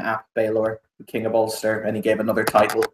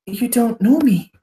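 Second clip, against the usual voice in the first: high, affronted aristocrat; haughty and hurt; offended noble; 12 semitones higher than usual. Acted. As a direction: feign wounded pride. Noble character miffed at clerk.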